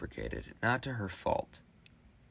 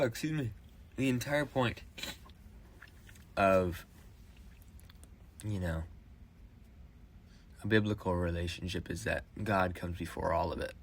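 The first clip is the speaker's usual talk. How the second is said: ordinary talk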